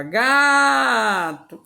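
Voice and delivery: "gato" with GA sung out very long; singsong stretched syllable. Shown as a demonstration. Teacher showing how to find the syllable, singsong "gato" with long GA. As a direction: chant "gato", lingering loudly on GA before a short TO. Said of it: "como se tivesse cantando".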